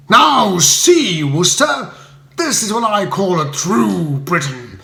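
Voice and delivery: a bully jabbing a finger at Bertie; pompous leader scolding, loud and high; blustering Spode. A narrator's reading.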